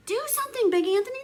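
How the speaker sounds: high, loud worried shout; crowd shouting. A narrator's reading. Townspeople begging Big Anthony for help, shouting. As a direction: shout it in alarm. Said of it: "they shouted".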